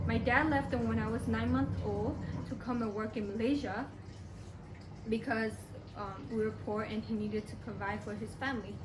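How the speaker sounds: ordinary delivery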